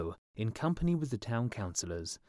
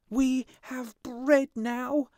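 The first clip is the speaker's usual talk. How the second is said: put-on much higher, louder, slow joyful cry of children; 7 dB louder, much slower than usual. A narrator's reading. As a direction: cry it out high like happy children. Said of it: "They cried".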